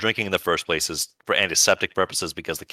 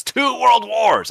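much higher and louder, slow hammed-up cheer; mock patriotic cheer; 7 dB louder, much slower than usual. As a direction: belt it out like a proud flag-waver. Mock patriotic cheer for American guns.

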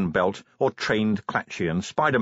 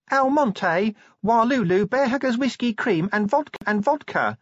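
Tawny's voice pitched much higher, sweet and light; airy young woman; 12 semitones higher than usual. Acted. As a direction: rattle off the list dreamily. Tawny reciting cocktail recipe.

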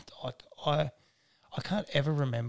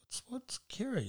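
mock puzzled coworker; 12 semitones higher, 5 dB quieter than usual.

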